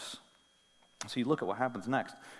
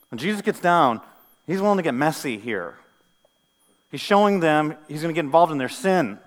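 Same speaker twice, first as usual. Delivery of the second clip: loud, emphatic proclamation; forceful preaching; 4 semitones higher, 9 dB louder than usual. Real, not acted. Preacher telling how Jesus takes on their sin, forceful preaching. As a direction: press the point home to the congregation.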